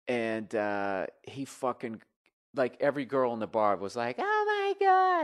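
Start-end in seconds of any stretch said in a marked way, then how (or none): 4.2-5.2 very high, drawn-out squeal; excited girls' squeal. Acted. Every girl spotting fake [name], high excited squeal.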